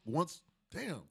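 usual speaking voice

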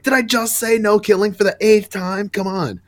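exasperated. Retold or imitated. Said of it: "Batgirl's like".

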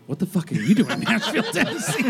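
fast, high, laughing through the words; laughing comeback. Retold or imitated. Host retelling his comeback to the rock star, laughing. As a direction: crack up mid-line as you fire it back.

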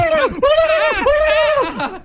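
frantic high-pitched turkey gobbles; long burst of mock gobbling. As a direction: imitate an attacking turkey, gobbling repeatedly. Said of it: "That was a good turkey impersonation".

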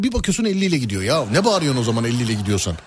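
ordinary talk

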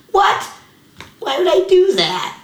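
incredulous at herself. Real, not acted. Mocking her own past rant at a ref, with high disbelief. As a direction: ask it as if you can't believe it.

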